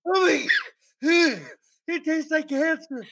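high pained cries with laughter; mouth burning from spicy food. Real, not acted. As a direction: reel from the burning shot. Still burning after shot.

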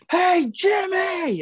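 high, drawn-out yell across the track. Retold or imitated. Young fan yells to Jimmy Johnson in the tunnel, high shout. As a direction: call out to him as loud as a kid can. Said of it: "I yelled and it, like, echoed".

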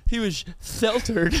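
"sheltered" lisped, sh becoming th; mock lisp. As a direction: tease with a th at the start of "sheltered". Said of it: "I have a lisp".

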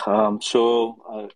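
ordinary talk, nothing marked

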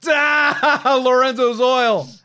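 howling laugh at the joke; laughing shout. Retold or imitated. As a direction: crack up and yell the pun. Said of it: "I just went".

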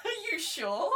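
high, loud laughing protest; mock disbelief. Actor reacting to being told she sounded American, mock disbelief. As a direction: act out your disbelief through laughter.

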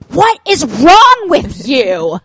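put-on slow, loud exasperated yell at a child; imitated scream. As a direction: yell it drawn out like the angry mom. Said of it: "screaming at her kid".

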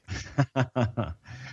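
quiet breathy laugh at a brutal joke; soft laugh. Real, not acted. Guest laughs quietly at the host's brutal joke. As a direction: laugh under the breath, caught off guard.

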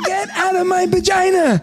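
put-on high, loud wailing little girl; toddler voice. Daughter on the potty, wailing in a high toddler voice. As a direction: cry it out like a frustrated toddler. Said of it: "She goes".

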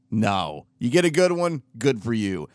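ordinary talk, nothing marked

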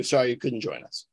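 ordinary talk, nothing marked

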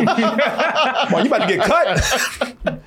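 high, laughing mock threat; laughing warning. Streamer warning co-host Martin, laughing and high. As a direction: tease him through laughter.

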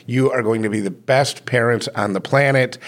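ordinary talk

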